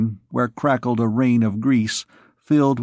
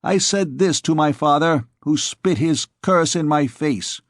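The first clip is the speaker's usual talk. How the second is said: higher, bold swaggering youth's voice; boisterous young scamp; 6 semitones higher than usual. Acted. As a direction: brag to the thieves like a reckless young noble. Jehan's voice held for long speech.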